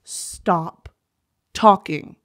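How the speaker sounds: normal talking voice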